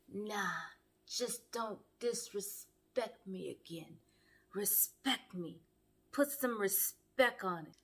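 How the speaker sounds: low, slow, hushed imitation of an offended man; mock stern demand. Vince telling Bree not to disrespect him, mock stern. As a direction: say it slowly and softly, spacing out the demand. Vince's sulky answer, acted.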